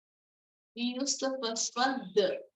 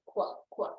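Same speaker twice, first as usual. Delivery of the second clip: whispered duck quacks; duck quacking; 4 semitones lower than usual. Shown as a demonstration. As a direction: quack quack under the breath. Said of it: "We're having the ducks".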